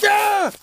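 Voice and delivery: high, loud yelp of fright; startled yelp. Acted. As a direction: jump with a yelp. Startled by Amoebi appearing.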